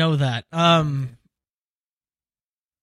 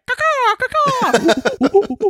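high shrieking caw caw; shrill screeching bird; 5 dB louder, much slower than usual. Pretend. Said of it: "those sound effects".

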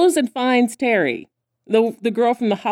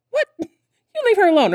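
protective scold; 11 semitones higher than usual. Retold or imitated. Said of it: "she's like".

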